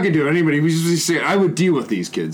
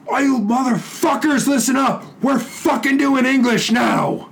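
high, slow yelled orders to the class; drill sergeant screech; 11 semitones higher, slower than usual. Retold or imitated. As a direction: bark it like a drill sergeant taking over the room. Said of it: "screeching at him like a drill sergeant".

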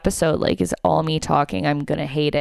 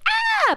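short, very high-pitched mock scream; imagined excited scream. Comedian showing how she thought she'd react, high mock scream. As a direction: squeal it out as the fangirl reaction you expected. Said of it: "I thought that I'd be like".

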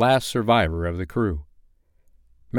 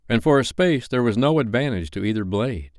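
plain reading voice

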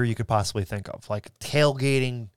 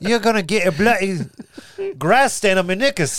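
hammed-up Cockney accent, higher; playful Cockney footballer, louder; 9 semitones higher, 5 dB louder than usual. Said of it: "Oh, you and your Cockney accent".

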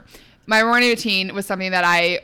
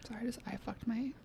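whispering; 3 semitones higher, 19 dB quieter, faster than usual. Real, not acted. Eye itch mishap.

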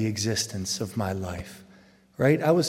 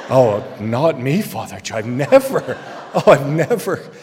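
mock innocence; 8 dB louder than usual. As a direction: play the saint who never sinned. Mock pious denial.

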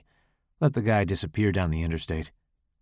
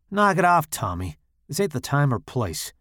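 firm, no-nonsense warning; real serious; 6 semitones higher than usual. A narrator's reading. Driver warns Tommy in the car, real serious. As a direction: shut him down, dead serious. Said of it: "I say real serious".

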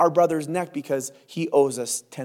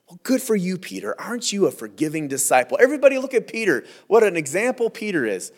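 higher, sarcastic fawning; mock praise; 4 semitones higher than usual. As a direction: gush with exaggerated, sarcastic admiration. Mock praise of Peter's boast.

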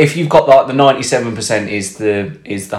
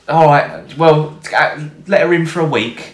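wife voice; 6 semitones higher than usual. Retold or imitated.

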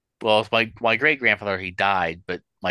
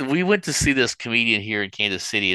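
usual speaking voice

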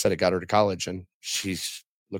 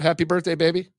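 higher, bright birthday wish to his wife; excited birthday greeting; 7 semitones higher, 4 dB louder than usual. Real, not acted. Husband wishes his wife happy birthday on air, beaming. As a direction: call it out with a grin.

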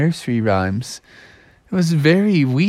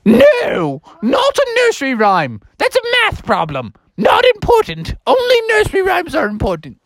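high, dismissive teacher goose; Mother Goose. Acted. Teacher rejects the mouse's answer, high indignant goose. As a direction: scold in a high falsetto. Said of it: "Mother Goose said".

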